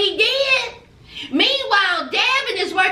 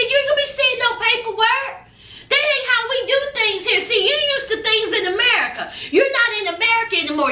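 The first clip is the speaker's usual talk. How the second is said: rapid lawyer lecture; 3 semitones higher, faster than usual. Retold or imitated. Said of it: "the lawyer said".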